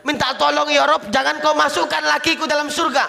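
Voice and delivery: high, insistent plea to God; acted plea. Wife begs God against her husband. (memohon dengan nada tinggi)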